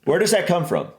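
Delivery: ordinary delivery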